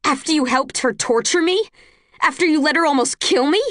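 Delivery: high, loud desperate screaming at her brother. A narrator's reading. Tris accuses Caleb of watching her torture, screaming. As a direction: scream it through tears of rage. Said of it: "I scream so loud my face gets hot".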